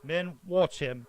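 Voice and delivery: slow loud command to his men; guard commanding. Acted. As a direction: keep the prisoner covered.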